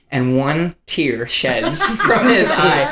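ordinary talk, nothing marked

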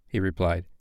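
usual speaking voice

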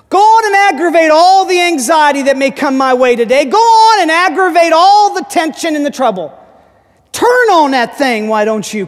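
high, rousing preacher's prayer; impassioned pulpit exhortation; full-force preaching. Acted. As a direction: drive it home to the congregation.

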